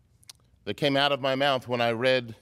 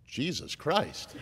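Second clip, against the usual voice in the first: drawn-out, swooping exclamation of disbelief; slow, less-than-spiritual oath; irreverent exclamation; 2 semitones higher, 6 dB quieter, slower than usual. Retold or imitated. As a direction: exclaim it slowly, with no piety at all. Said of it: "spoke of him in a less than spiritual tone".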